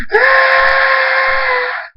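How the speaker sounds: very high, sustained scream; long scream. A narrator's reading. Thunderstorm screams as the storm roars, long scream. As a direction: hold a high scream for a full breath. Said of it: "Thunderstorm screams".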